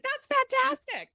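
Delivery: drawn-out high "fantastic"; gushing approval. Retold or imitated. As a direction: stretch it out like you're thrilled for her. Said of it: "and I'm like".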